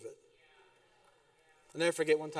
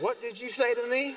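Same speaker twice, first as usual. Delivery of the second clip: wife's warning challenge; 6 semitones higher than usual. Retold or imitated. Wife confronting husband.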